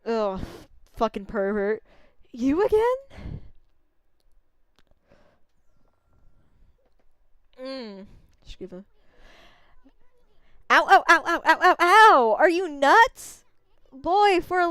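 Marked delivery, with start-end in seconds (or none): 2.3-8.8 higher, cocky little bug voice; a brash tiny braggart; Issun voice. Acted. Tiny bug artist bragging to the wolf, higher and cocky. 10.7-12.3 loud, higher ow ow ow; yelping from being bitten; pained yelps. Acted. Bug yelping as the wolf bites him, loud.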